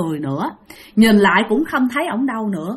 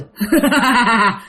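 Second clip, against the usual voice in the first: eerie chuckle of the grinning stranger; spooky imitated laugh; creepy laugh. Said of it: "Cứ cười vậy đó".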